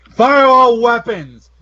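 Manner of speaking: slow, loud barked order; shouted command. Acted. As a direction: yell it out like a commander.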